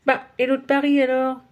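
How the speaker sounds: put-on a small boy reminding her; a hint of a hurt little boy; boy's voice. A narrator's reading. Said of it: "répond Nicolas".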